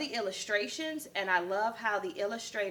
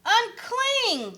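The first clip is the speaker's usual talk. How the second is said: high loud call; 13 semitones higher, 6 dB louder than usual. Acted. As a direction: call up to the tree. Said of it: "the crowd called".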